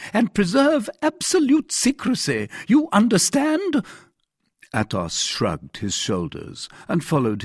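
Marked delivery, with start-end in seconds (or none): 0.1-3.9 very high, insistent command; crying out. A narrator's reading. Commissary demanding secrecy from Athos, crying out. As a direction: press the order home, high and emphatic. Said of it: "cried the commissary".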